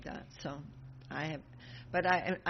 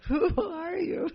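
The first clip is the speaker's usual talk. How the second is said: high, loud amazed question; astonished cry; 11 semitones higher, 8 dB louder than usual. Acted. Bhima amazed by the monkey's strength, high and loud. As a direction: blurt it out in shock.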